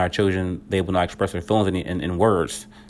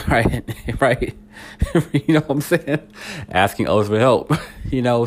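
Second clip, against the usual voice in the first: laughing; 4 semitones higher than usual. Real, not acted. Laughing at own point.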